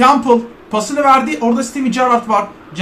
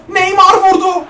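higher, excited shot call; excited match call; 6 semitones higher, 3 dB louder than usual. Real, not acted. Gamer commentating Neymar's shot, excited call.

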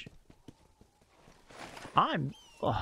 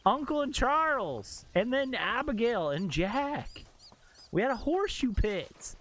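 outraged rant; 7 semitones higher than usual. Real, not acted. Ranting at squatters wrecking house.

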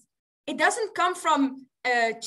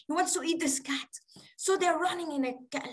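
ordinary delivery

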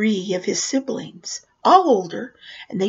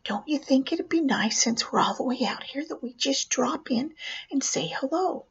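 whispering; 5 semitones higher, 6 dB quieter than usual. Retold or imitated. Mom's suggestion whispered.

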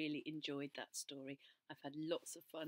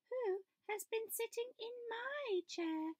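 much higher mama bear voice; higher, slower mama bear; Mother Bear. A narrator's reading. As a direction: lift it into a prim bear voice.

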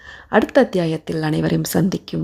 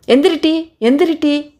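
high, insistent command; rough rousing order; 8 semitones higher than usual. A narrator's reading. Perumal rouses sleeping Erukku with a hit, insistent order. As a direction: order her up, urgently.

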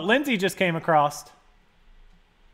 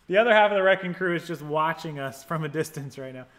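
normal talking voice

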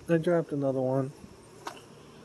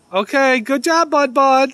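high, loud pet praise; pitched-up, projected cheer; praising a pet; 10 semitones higher, 9 dB louder than usual. Real, not acted. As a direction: call out praise to an animal friend. Praising the squirrel from afar.

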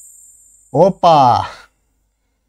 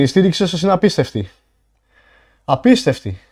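normal talking voice

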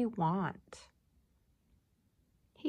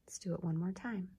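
quick soft whisper; whispered aside; 7 dB quieter, faster than usual. Real, not acted. Teacher quickly whispers to repeat the word. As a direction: whisper the instruction fast.

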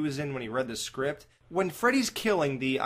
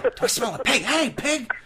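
high, loud sneering call; O'Malley impression; 10 semitones higher, 5 dB louder than usual. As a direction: taunt like a stoner jeering at a cop. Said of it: "quoting Cliff O'Malley".

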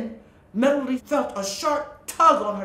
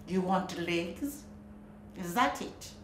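croaking witch; 4 semitones lower, 7 dB quieter than usual. Acted. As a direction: croak it out like an old sea witch. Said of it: "the witch croaked".

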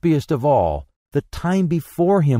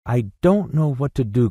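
ordinary delivery, nothing marked

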